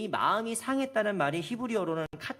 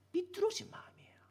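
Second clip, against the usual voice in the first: breathy whispered 삐뚤어진 마음이에요; 13 semitones higher, 4 dB quieter than usual. Real, not acted. Preacher stresses crooked heart. (삐뚤어진을 속삭이듯 강조)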